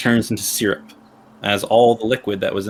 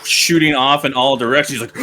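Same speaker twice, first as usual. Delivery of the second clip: ordinary talk, nothing marked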